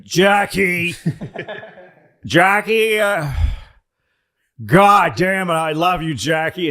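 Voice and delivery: put-on a drunk president calling out a woman's name; drunk LBJ. Comic imitating drunk LBJ calling Jackie, loud and slurred. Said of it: "he's like".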